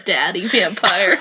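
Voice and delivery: low, slow mock-sultry purr; mock seductive. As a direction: drop low and tease it. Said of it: "Seduce me".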